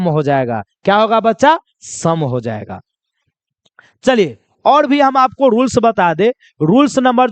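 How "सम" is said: "सम" with a short uh vowel, low and soft; exception pronunciation. Shown as a demonstration. Teacher repeating the answer for students, short low vowel. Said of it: "इसका pronunciation क्या हो जाएगा".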